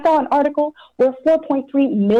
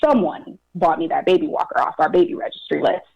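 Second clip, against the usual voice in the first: ordinary delivery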